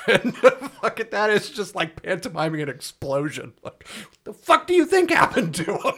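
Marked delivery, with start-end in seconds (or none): 3.9-5.9 high, incredulous outburst; hammed-up sarcastic retort through laughter; mock exasperation. Jack answering a question about explosion, exasperated.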